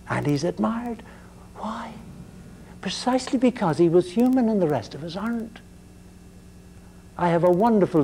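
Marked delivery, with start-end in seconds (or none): 1.6-1.9 a soft, breathy whispered Why; whispered question. Real, not acted. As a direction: lecturer whispers a rhetorical question to the class, hushed. Rhetorical question to class.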